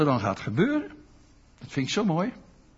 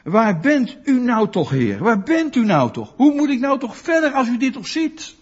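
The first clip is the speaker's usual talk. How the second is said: louder, higher anguished cry to God; desperate plea; 7 semitones higher, 5 dB louder than usual. Retold or imitated. Preacher voicing a grieving believer, pleading loudly. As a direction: cry out to the Lord in despair. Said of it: "Als je denkt".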